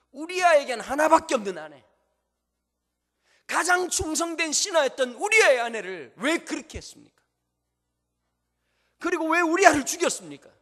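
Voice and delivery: loud, high, stern accusation; prophet's rebuke. Acted. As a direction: confront the king and demand an answer. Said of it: "나단이 락커가 된 거죠". (왕을 꾸짖는 선지자의 크고 높은 질책)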